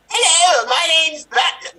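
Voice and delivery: very high, loud, strained squeal; trial superhero voice. Acted. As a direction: squeal out a failed intimidating hero voice. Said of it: "my voice for when I find the bad guys".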